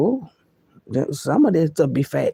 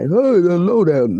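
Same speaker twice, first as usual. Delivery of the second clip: deep voice of God; 6 dB louder, slower than usual. Retold or imitated. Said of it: "not gonna hear the voice".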